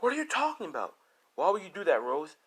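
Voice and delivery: high-pitched, baffled questioning; acted disbelief. Character confronts Rose in retold quarrel.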